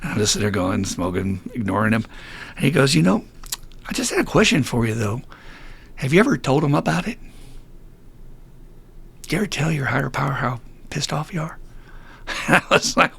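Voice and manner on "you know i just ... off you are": high, put-on voice of an older man; imitated old timer gently probing. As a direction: ask it gingerly, with long pauses. Old timer's probing question retold.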